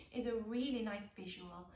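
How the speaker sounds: ordinary talk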